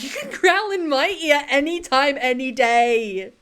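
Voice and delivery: loud, high gushing reply, last words drawn out; playful over-keen exclamation; mock swooning. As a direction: blurt it out like a smitten fan.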